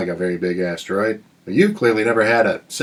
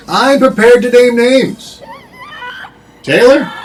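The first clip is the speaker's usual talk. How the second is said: dramatic declaration; 11 semitones higher, 6 dB louder, slower than usual. Acted. Mock dramatic threat.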